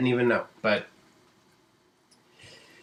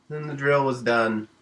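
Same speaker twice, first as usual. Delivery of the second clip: usual speaking voice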